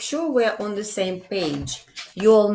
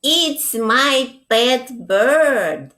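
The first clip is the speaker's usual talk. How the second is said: slow and louder, proud little mole; Mole's proud voice; 6 semitones higher, 5 dB louder, much slower than usual. Acted. Mole claims the bird to his mom, slow, loud and proud. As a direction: drag it out proudly like a small child claiming a pet. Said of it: "Mole would say".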